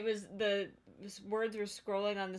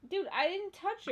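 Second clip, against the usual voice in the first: protesting; 11 semitones higher, 4 dB louder than usual. Real, not acted. Protesting innocence to friend.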